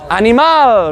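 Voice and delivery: animal with a clear open final a, Spanish style; Spanish pronunciation. Shown as a demonstration. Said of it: "Very good".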